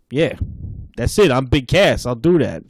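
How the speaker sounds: hammy New York tough guy as Big Cass; bad New York accent. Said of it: "really bad New York accent".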